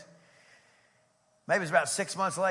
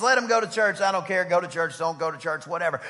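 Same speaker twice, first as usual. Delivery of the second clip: dismissive shrug; 6 dB louder than usual. Acted. Voicing weak dismissive attitude.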